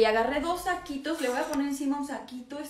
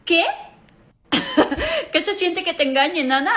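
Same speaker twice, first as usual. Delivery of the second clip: usual speaking voice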